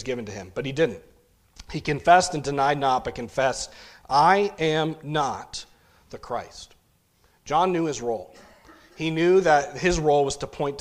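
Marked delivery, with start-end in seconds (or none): 4.1-6.7 slow, drawn-out stress on I and NOT; emphatic. Acted. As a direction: declare it in weighty, separated words. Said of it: "John was emphatic".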